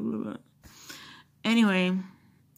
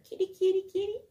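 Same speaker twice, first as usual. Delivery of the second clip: higher sing-song kitty call; calling the cat; 12 semitones higher, faster than usual. Real, not acted. Owner calling her cat over, higher coaxing kitty call.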